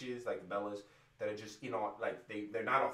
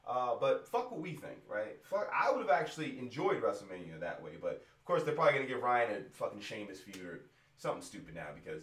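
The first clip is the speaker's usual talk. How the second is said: normal talking voice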